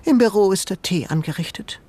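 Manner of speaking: lightly voiced old lady, cool and polite; a hint of a stately old woman smiling coolly; cool smile. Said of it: "mit einem kühlen Lächeln".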